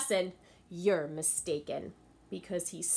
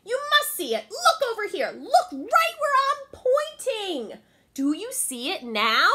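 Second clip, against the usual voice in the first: angry Anansi; 10 dB louder than usual. Acted. Anansi getting angry at Little Bush Deer, shrill demand. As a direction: demand it, losing his temper. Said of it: "Anansi began to get angry".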